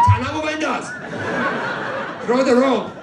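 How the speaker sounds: high, loud hollering for the rope; shouting crew. Pretend. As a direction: yell orders as a comic skydiving crew. Acts out Iranian skydiving crew shouting.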